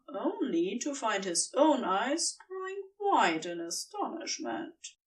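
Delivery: usual speaking voice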